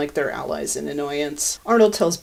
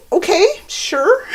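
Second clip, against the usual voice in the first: amused disbelief, laughing; 12 semitones higher, 6 dB louder than usual. Real, not acted. Incredulous reaction to costume choice.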